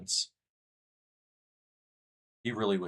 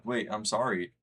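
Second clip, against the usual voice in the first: normal talking voice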